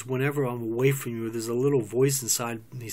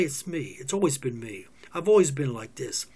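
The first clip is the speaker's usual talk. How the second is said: ordinary talk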